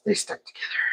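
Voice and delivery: whispered, secretive; hushed aside. Pretend. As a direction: breathe it out like gossip. Voicing the chip lovers.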